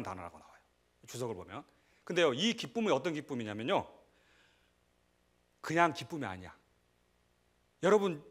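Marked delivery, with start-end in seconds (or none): none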